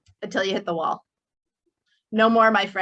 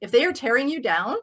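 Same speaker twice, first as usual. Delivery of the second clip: ordinary delivery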